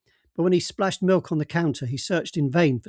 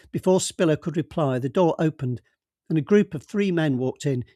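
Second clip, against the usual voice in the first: plain reading voice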